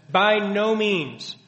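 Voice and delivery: a strong, slow rebuke; emphatic. Retold or imitated. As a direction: lay each word down firmly, slowly. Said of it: "Paul's response is emphatic and strong".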